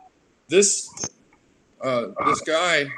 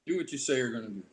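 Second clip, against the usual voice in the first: soft echo; 7 dB quieter, much faster than usual. Retold or imitated. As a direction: let the lesson sink in softly. Same lesson repeated as soft echo.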